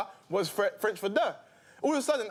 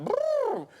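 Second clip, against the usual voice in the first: high trumpeting elephant noise. Retold or imitated.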